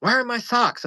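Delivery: put-on drunk mom. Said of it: "she was like".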